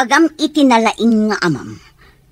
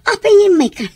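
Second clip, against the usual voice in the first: indignant; 8 semitones higher, 5 dB louder than usual. Acted. Mother confronts her daughter Rita.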